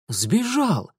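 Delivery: a sudden dismayed realisation; gasp. A narrator's reading. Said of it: "ахнул он".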